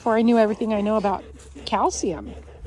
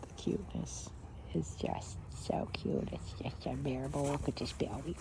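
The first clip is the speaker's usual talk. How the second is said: whisper; 9 semitones lower, 16 dB quieter than usual. Real, not acted. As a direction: breathe it out, overcome by cuteness. Overwhelmed by puppy cuteness.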